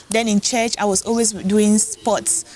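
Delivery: ordinary talk, nothing marked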